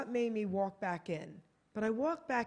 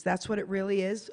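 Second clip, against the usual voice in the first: ordinary talk, nothing marked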